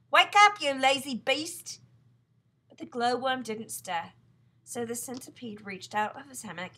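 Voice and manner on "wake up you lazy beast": much louder, higher yell to wake someone. Acted. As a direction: shout her awake, slowing on lazy beast. Centipede yelling at sleeping glowworm.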